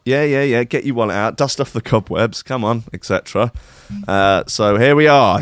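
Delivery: ordinary talk, nothing marked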